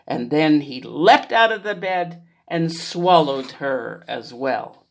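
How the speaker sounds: ordinary delivery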